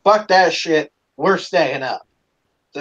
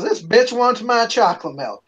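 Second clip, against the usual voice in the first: higher-pitched indignant rant breaking into laughter; mock indignation; 6 semitones higher than usual. Brother complains to grandmother, high indignant mimicry. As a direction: mimic a brother guarding what's his. Brother refuses to share milk.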